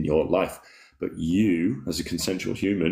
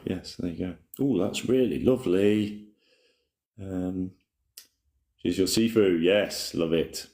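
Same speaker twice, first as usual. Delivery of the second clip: ordinary talk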